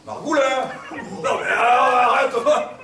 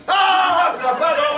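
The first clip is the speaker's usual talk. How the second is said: mock shout; 6 semitones higher, 5 dB louder, much slower than usual. Réplique énervée.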